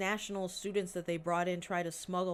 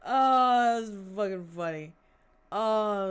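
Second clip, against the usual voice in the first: laughing; 3 semitones higher, 10 dB louder than usual. Real, not acted. Still laughing after joke.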